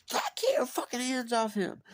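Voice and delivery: high mock shriek of the sister; sister screaming. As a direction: scream it at the men holding her. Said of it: "And she's".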